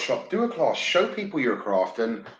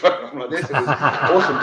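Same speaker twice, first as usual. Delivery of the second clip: laughing and louder through it; 6 dB louder than usual. Real, not acted. Telling his friend about the wood-loaded car, laughing. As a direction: laugh it out, delighted.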